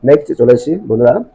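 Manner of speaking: normal talking voice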